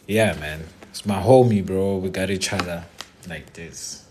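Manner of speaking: ordinary talk, nothing marked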